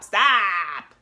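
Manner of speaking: loud, sharp shouted stop. Real, not acted. Shouting stop at mothers who shame crying sons. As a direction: yell it at them.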